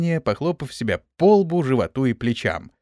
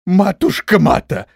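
loud, high, drawn-out appeal; hollering; 5 semitones higher, 6 dB louder than usual. A narrator's reading. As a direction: yell out to the goddess for help. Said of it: "заорал генерал". (громкий протяжный вопль)

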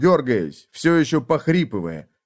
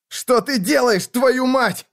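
much higher, furious outburst; angry yell; 13 semitones higher, slower than usual. Acted. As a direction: shout at the betrayer. Wounded man yells at traitor.

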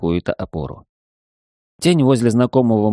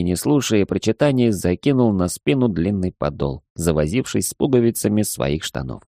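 ordinary delivery

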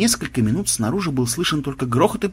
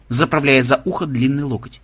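ordinary delivery, nothing marked

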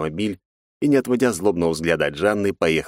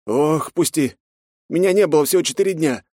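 exhaled protest; 10 semitones higher, 4 dB louder than usual. Acted. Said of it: "выдохнул он".

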